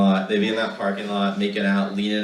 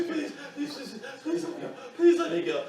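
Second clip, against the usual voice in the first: tiny desperate plea; 7 dB quieter than usual. Retold or imitated. Pinned, quiet begging.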